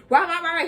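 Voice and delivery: mock noisy shouting; mock rowdy barking. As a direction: bark it out like a rowdy guy. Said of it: "getting all rowdy and rowdy".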